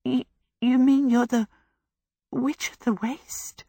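frightened, breathy quaver; quavering with fear. Acted. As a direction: stammer it out in shock. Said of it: "Sophie quavered".

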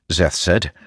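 ordinary delivery, nothing marked

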